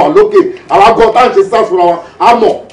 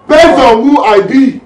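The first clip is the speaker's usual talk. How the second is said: loud, raised, drawn-out vow; forceful vow; 5 semitones higher, 6 dB louder, much slower than usual. Acted. Squad leader swearing to his squad, forceful vow. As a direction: stretch it slowly and proclaim it loudly.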